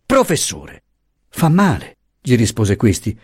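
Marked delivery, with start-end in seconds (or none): 0.0-0.8 restrained, higher-pitched shout; crying out. A narrator's reading. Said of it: "gridò". 1.3-2.0 heated reproach. A narrator's reading. Professor's heated protest.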